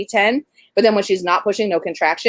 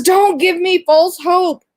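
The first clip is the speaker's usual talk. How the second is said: high, drawn-out, louder plea; imitated tearful protest; 11 semitones higher, 5 dB louder, much slower than usual. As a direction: act out an upset friend refusing comfort. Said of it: "she's crying".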